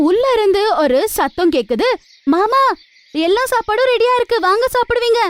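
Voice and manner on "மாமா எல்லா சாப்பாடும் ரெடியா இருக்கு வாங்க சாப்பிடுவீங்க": put-on young wife calling. Daughter-in-law calls family to eat.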